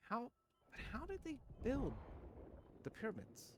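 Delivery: very high-pitched mock bewilderment; mock baffled. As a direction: repeat the question in a tiny, high falsetto. Repeats question in mocking high voice.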